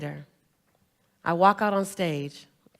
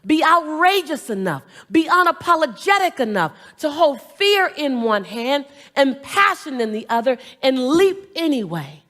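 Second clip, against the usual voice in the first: motivational preaching; 4 semitones higher, 7 dB louder than usual. Acted. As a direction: preach it to the crowd. Motivational call to audience.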